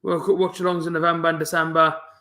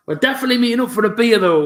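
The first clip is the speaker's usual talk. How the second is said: higher, louder and fast, bursting with eagerness; excited promise; 5 semitones higher, 8 dB louder, faster than usual. Real, not acted. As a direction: fire it off like you can't wait to meet him. Promising a viewer a beer.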